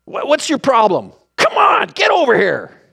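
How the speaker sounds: a hammed-up irritated Jesus shouting at Peter; mock temper tantrum. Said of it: "throw a temper tantrum".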